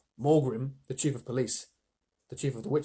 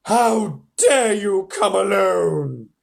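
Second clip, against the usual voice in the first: slow, loud, menacing queen; terrible witch voice; 6 semitones higher, 10 dB louder, much slower than usual. Acted. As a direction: loom over him, drawing it out. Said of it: "said the witch in a terrible voice".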